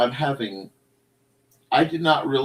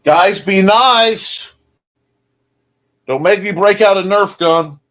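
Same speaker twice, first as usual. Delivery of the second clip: scolding; 9 semitones higher, 11 dB louder, slower than usual. Real, not acted. Scolding chat fighting.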